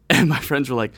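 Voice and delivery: ordinary talk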